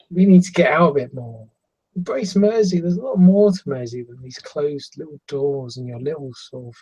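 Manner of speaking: animated youngster fussing over someone; bright-eyed Lil Red. Acted. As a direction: coax an old friend out, with fond concern. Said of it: "looks back at you with his, this, this bright eyes and his wide grin, going".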